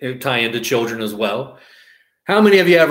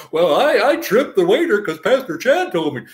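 higher, singsong mock excuse; 6 semitones higher than usual. Listener blaming the pastor for tripping a waiter, mock excuse. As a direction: imitate someone making a silly excuse.

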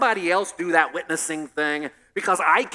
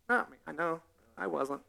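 hushed denial; 3 semitones lower, 10 dB quieter than usual. Retold or imitated. Peter denying quietly.

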